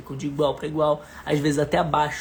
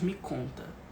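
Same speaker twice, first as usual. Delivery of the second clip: gentle, low invitation to talk; soft "me conta"; 4 semitones lower, 9 dB quieter, slower than usual. Shown as a demonstration. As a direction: say it softly, like water. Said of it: "Eu falo um pouco mais baixo". (convite suave e baixinho)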